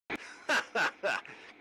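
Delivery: amused chuckle; short laugh at the joke. Acted. Laughs at the nickname.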